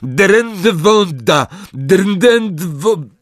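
high, slow spell cry; Neville's spell shout. A narrator's reading. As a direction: cast it with all he has. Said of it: "krzyknął ponownie Neville". (wysoki, wolny okrzyk zaklęcia)

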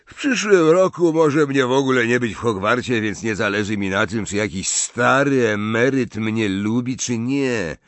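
exaggerated yawny boast; ostentatious yawning. A narrator's reading. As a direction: yawn out the words to seem bored. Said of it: "ziewnął ostentacyjnie".

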